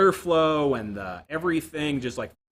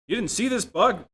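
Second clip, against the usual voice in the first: higher, indignant mock accusation; mock accusing; 8 semitones higher, 4 dB louder than usual. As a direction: act out an annoyed boss blaming you. Business voiced yelling about bug.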